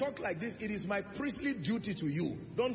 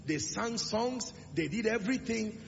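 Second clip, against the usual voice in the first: ordinary talk, nothing marked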